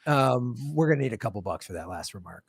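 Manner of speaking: deep, low, quiet menacing collector voice; mock YouTube enforcer. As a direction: lean in like a shady debt collector. Repeats YouTube rep bit.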